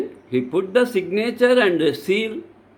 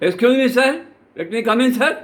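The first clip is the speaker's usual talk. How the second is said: high-pitched, brisk impolite request; 5 semitones higher, faster than usual. Shown as a demonstration. Student barging in on an official, impolite. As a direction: demand entry in a raised pitch, no deference. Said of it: "This is wrong".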